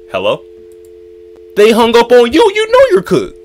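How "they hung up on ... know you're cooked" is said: very high, sing-song taunting voice; ribbing himself he's doomed; mocking taunt. Real, not acted. After the takeaway hangs up, mocking himself in a high taunt.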